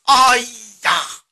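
loud, drawn-out cry of shock; a storyteller's big shocked exclamation; startled cry out. A narrator's reading. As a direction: cry out in sudden alarm. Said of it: "不由大叫一声".

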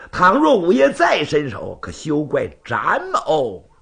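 slow, drawn-out threat building to a high loud finish; theatrical final warning from a swordsman; stern warning. Acted. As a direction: stretch the last words, then burst out. Zhan's final warning in story.

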